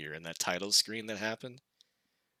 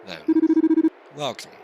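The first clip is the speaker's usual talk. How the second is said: eerie entity; 13 semitones higher, 10 dB louder than usual. Acted. Game entity voice.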